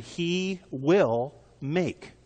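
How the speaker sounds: "will" drawn out and set apart; he... will... make, each word held, weight on "will"; stress on will. Shown as a demonstration. Preacher underlining a promise from Proverbs, stress on "will". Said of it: "I want to emphasize the word will".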